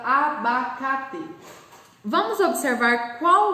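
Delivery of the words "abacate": "abacate" slowly broken into a-ba-ca-te; syllable by syllable. Shown as a demonstration. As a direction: sound out each syllable of "abacate", final "te" as a clear teh. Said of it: "vamos separar a sílaba".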